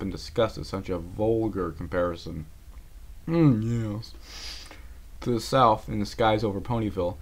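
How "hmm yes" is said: haughty hum; disdainful. Acted. Rarity sniffs at the pig comparison, haughty. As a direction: sniff at a vulgar remark.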